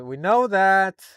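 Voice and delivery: loud slow fed-up We know that; exasperated shout. Real, not acted. Tired of the recap, a loud slow groan of complaint. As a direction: drag it out in irritation.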